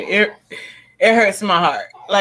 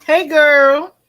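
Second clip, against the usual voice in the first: bright greeting; 5 semitones higher, 6 dB louder than usual. Real, not acted. Greeting a guest joining the panel.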